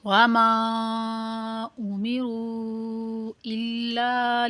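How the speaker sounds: slow tajweed recitation with long drawn vowels; Quran recitation. Shown as a demonstration. Teacher reciting the surah for learners, measured tajweed recitation. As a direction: recite slowly, stretching the long syllables.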